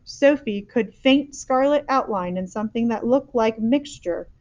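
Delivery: plain reading voice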